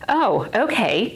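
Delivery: high, drawn-out sarcastic okay; mock agreement. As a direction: go along with it in mock disbelief. Sarcastic reply to husband's advice.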